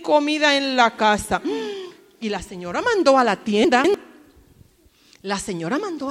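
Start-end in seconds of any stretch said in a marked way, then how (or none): none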